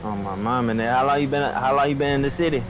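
normal talking voice

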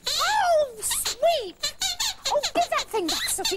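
high, urgent protest; exasperated plea. Acted. Girl to the dog who took her thing, exasperated plea. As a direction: plead high for it back.

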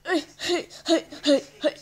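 sharp, high, loud heys; calling out. Real, not acted. Child calling out mid-game, shouted heys. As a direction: call out in quick loud bursts.

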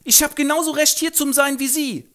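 sehr hoch, laut, prollig; Straßengöre. Acted. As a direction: patzige Göre im Jugendslang. Eliza widerspricht. (hohe, laute Prollstimme)

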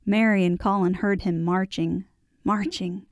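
ordinary delivery

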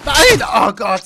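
sharp outburst at a mishap; dismayed cry. Real, not acted. Cries out at game mishap.

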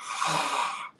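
breathy, voiceless throat fricative; throaty Greek ch. Shown as a demonstration. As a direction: demonstrate a raspy exhaled h sound. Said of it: "there's this funny sound".

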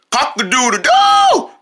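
slow rooster crow. Retold or imitated. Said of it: "The rooster goes".

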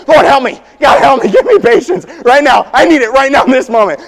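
high, loud pleading cry; desperate prayer shout. Retold or imitated. Dad begging God for patience amid dinner chaos, loud shout. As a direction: yell for patience at the end of your rope. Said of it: "they are very audible".

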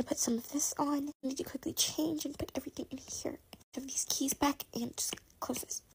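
hushed whisper, sneaking. Acted. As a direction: whisper so no one hears. Sneaking, hiding evidence.

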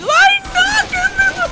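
chillido muy agudo y fuerte; grito de susto. Acted. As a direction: chilla asustado. Susto en el juego. (Whis chilla de susto, muy agudo y fuerte)

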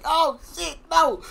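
laughing mock panic. Acting the panic at scalding water.

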